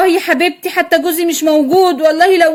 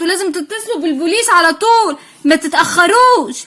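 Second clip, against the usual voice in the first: ordinary talk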